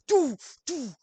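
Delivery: high, loud mock banging noise; imitated window banging. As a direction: make the sound of fists on glass.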